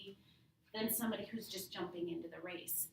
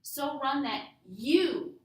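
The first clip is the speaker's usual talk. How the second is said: YOU punched loud and drawn out at the end; slow down and land hard on "you"; stress on YOU; 5 semitones higher, 8 dB louder, slower than usual. Shown as a demonstration. Teacher says this is for the reader, stressing YOU. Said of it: "Maybe that's what stood out to you".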